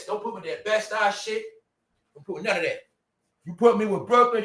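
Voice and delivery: ordinary talk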